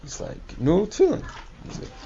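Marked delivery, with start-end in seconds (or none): 0.6-1.2 much higher, rising "Nu tefillin"; mock foreign man repeating, pitched up; insistent repeat. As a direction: repeat it as if he didn't hear. Man repeating the offer louder.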